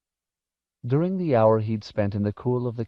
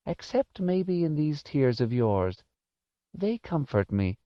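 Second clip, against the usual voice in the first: put-on high, slow, wistful old lady; elderly woman taking comfort in a child; tender old woman; 6 semitones higher than usual. A narrator's reading. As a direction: speak slowly and tenderly, pitched up. Voices Missus Griffin, moved.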